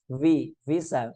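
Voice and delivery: V, visa slowly, lip-teeth voiced v; English V sound. Shown as a demonstration. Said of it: "seperti huruf V dalam bahasa Inggris".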